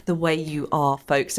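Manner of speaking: usual speaking voice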